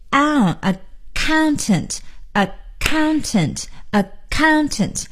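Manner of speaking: accountant with a weak first syllable and a strong, long COUN; stress on second syllable. Shown as a demonstration. Teacher showing where the stress falls, second syllable stressed. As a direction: slowly punch the COUN in accountant. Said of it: "重音呢要在第二音节".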